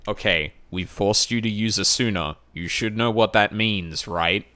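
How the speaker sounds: loud sarcastic ribbing; mocking game voice. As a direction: rub it in like a smug designer. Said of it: "so it's like".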